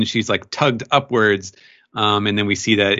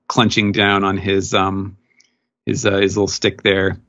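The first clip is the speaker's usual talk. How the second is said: ordinary delivery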